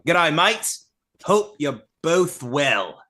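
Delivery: attempted Australian accent, drawn-out ending; broad amateur Australian, slowing down at the end; Aussie accent. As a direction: put on an Aussie greeting. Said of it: "try to get that Aussie".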